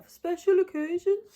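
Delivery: put-on high, loud six-year-old voice; little kid voice. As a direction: squeak it like a little child. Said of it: "Me as a little six-year-old with my northern accent".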